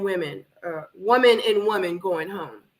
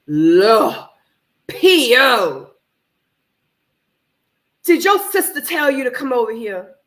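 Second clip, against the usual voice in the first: calling out; 3 semitones higher, 8 dB louder than usual. Real, not acted. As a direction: call out to P O on TV. Yelling at the TV contestant.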